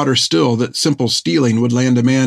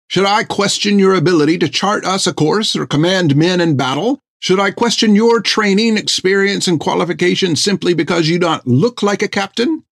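a prim, affronted physician, pitched higher; indignant fussy doctor; 7 semitones higher than usual. Acted. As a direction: scold the captain with offended dignity. Thiggum's indignant speech.

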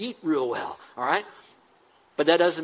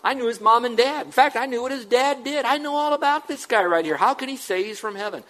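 higher-pitched, disbelieving neighbour's protest; incredulous grumbling; 7 semitones higher, 3 dB louder than usual. Acted. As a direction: object as someone who knows his family. Acting out a sceptical neighbour's grumbling.

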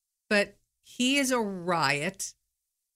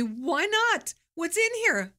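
tags 1; 10 semitones higher than usual. Retold or imitated. Boyfriend voiced in retold story.

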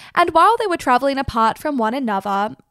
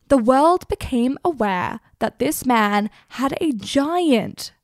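ordinary delivery

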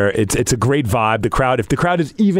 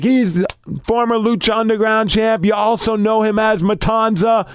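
higher-pitched wrestling introduction; ring announcer; 8 semitones higher than usual. Retold or imitated. Ring announcer introducing the wrestler, announcer voice. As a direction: announce him to the crowd. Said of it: "in the ring, doing his thing".

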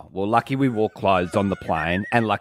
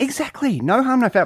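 high-pitched carefree exclaim; high playful voice; 12 semitones higher than usual. Pretend. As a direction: toss it off, high and breezy. Carefree traveller persona joke.

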